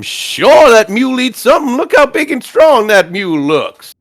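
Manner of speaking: high, loud, hearty sales patter; folksy bard haggling. Acted.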